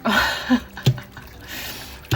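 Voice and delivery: a light breathy laugh. Real, not acted. Laughs at the reading.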